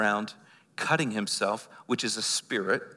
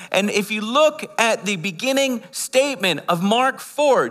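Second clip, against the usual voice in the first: high, loud, driving sermon voice; fervent preaching; 7 semitones higher, 5 dB louder than usual. Acted. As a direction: preach it out to the whole room. Preaching at full force.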